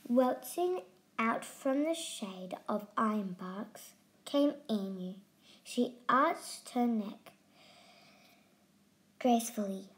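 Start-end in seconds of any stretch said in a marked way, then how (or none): none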